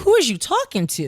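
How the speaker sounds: high, loud mock indignation; indignant retort. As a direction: throw it back high and loud, affronted.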